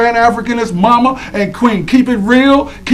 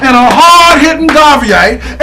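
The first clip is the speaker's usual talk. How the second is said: excited hype; 6 semitones higher than usual. Real, not acted. Hyping up an honoree.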